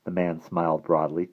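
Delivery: plain reading voice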